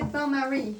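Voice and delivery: bain-marie said the French way, nasal "bain" and "marie" stressed at the end; French accent. Shown as a demonstration. Herbalism teacher asking the class about the term, French accent. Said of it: "'Cause I say it with a French accent".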